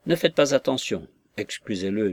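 plain reading voice

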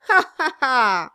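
loud, high acted ha-ha-ha; hearty laugh. A narrator's reading. Dwarf laughing after toasting the future bride, boisterous.

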